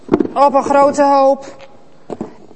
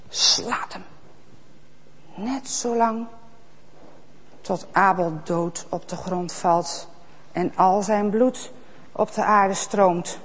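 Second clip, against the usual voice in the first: much softer, lower, hushed; hushed grim telling; 4 semitones lower, 7 dB quieter than usual. A narrator's reading. Narrator telling children Abel's death, hushed. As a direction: drop the voice for the dark part.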